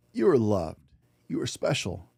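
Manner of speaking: ordinary delivery, nothing marked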